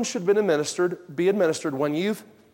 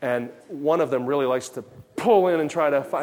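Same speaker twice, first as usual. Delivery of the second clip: usual speaking voice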